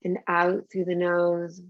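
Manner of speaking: slow, calm breathing guidance; guided meditation. Acted. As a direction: soothe them through each breath.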